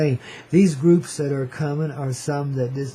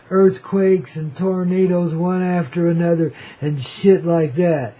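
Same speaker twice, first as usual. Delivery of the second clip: ordinary delivery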